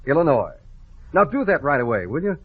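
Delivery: ordinary talk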